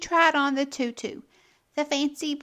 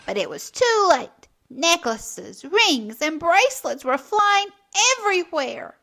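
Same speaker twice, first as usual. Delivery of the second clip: usual speaking voice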